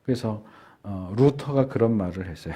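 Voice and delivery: ordinary delivery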